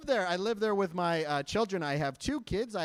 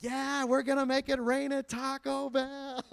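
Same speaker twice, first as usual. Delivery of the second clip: slow, high mock brag; mock boast; 10 semitones higher than usual. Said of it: "I was like".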